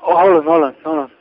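impatient caller talking over the other; loud interruption. Real, not acted. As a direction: cut him off firmly and loud. Scammer cuts off caller.

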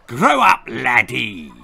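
loud bark dropping in pitch; harsh military put-down; barked unpleasantly. A narrator's reading. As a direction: bark it like a nasty sergeant. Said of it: "he barked unpleasantly".

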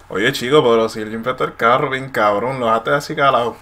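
loud, impressed Puerto Rican Spanish; praising a clean car, Puerto Rican style; Puerto Rican accent. Shown as a demonstration. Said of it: "for example".

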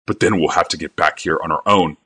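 ordinary delivery, nothing marked

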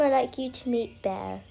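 ordinary talk

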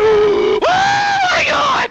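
gasp, then a high loud wail; very high, loud exclamation; shocked cry. Real, not acted. Gasps, cries out in shock.

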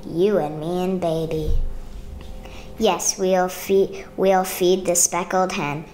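gentle sing-song picture-book reading; reading to children. A narrator's reading. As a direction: read it like a bedtime story to a child.

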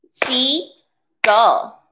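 "Seagull" split in two, sea, pause, gull; careful syllable-by-syllable "Seagull", long gap after "sea"; two separate syllables. Shown as a demonstration. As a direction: say "Seagull" in two distinct beats. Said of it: "Listen carefully".

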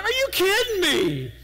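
high, laughing mock outrage; half-laughing, half-crying mock exasperation. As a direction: play up the annoyance with a laugh in it. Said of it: "I'm so mad".

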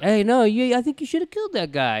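high, fast mock urging; imitating Jimmy. Friend retelling Jimmy egging Junior on, high mock urging.

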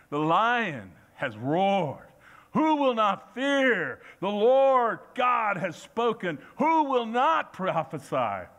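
slow, ringing preacher's question; booming scripture reading. Acted. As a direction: thunder out the prophet's questions. Reads Amos with force.

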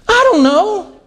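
put-on high-pitched child's helpless answer; clueless child. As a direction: answer like a kid with no idea. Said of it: "what will the child say?".

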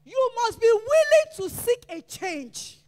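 loud, driving sermon cadence; preaching at full force. Acted. Pastor urging the congregation, preaching at full force. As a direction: hammer each line home to the church.

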